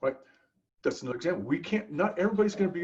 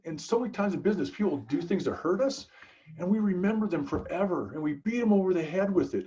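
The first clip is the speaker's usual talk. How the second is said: ordinary talk, nothing marked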